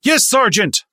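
very high, very loud recruit scream; screamed reply. A narrator's reading. Recruits answering their sergeant, all screaming. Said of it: "they all screamed simultaneously".

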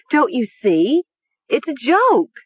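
high, loud giddy insistence; giddy cry. A narrator's reading. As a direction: cry it out giddily. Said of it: "Nessa cried".

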